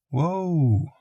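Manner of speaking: high, drawn-out gentle whoa; soothing. A narrator's reading. Calming a baby who started crying again, soothing and high.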